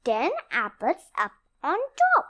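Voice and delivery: ordinary delivery, nothing marked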